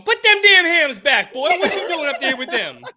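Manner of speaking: high, loud, slow scolding with a laugh; high mock-scolding voice; Monica Kaiser impression. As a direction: scold the boy like a sassy host. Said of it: "Monica Kaiser going".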